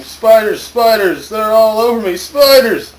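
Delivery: loud, high-pitched screams of panic; panicked screaming. A narrator's reading. Story reader voices guard covered in spiders, panicked screaming. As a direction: scream as if crawling with them. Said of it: "He was screaming".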